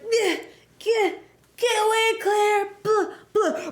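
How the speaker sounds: high strained cries breaking into monster noises; mock transforming Steve. Steve warning Claire while transforming, mock strangled cries. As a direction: choke out a hammed-up warning.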